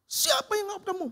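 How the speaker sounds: high, loud angry demand; angry boss. Retold or imitated. Boss angrily demands who fired his employee. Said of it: "Marah dia".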